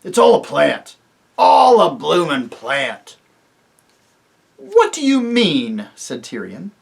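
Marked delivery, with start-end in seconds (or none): 0.0-3.2 loud, slow sneering dwarf; scornful dwarf. Acted. A dwarf dismisses the rescue as a trick, loud and scornful. 4.6-5.9 higher, loud, slow disbelieving king; a king who cannot believe his ears; king, stunned. Acted. Tirian asks the dwarfs, loud and stunned.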